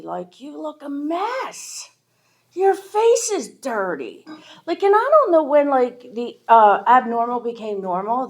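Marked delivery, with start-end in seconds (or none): 0.4-4.3 higher, slow mock scolding without real volume; mom scolding. Mom scolding her hungover kid, a mild mock yell. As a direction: nag like a fed-up mother, drawn out and pitched up. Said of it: "my mom screaming at me".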